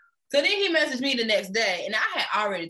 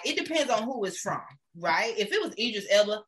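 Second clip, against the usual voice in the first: ordinary talk, nothing marked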